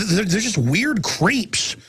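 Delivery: ordinary delivery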